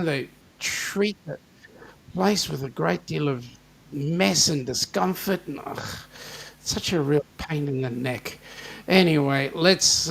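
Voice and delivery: usual speaking voice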